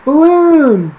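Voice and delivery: put-on high, loud little girl's shout; excited kid shouting; child's shout; child calling. A narrator's reading.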